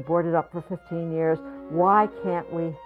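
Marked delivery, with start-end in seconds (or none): none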